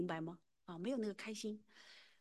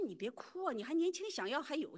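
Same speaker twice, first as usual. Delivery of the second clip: higher-pitched imitation of a doctor consoling her; 7 semitones higher, 5 dB louder than usual. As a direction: lightly voice a doctor urging her not to cry. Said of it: "他说". (模仿医生提高音调劝慰)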